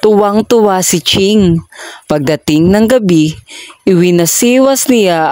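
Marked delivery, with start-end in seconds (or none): none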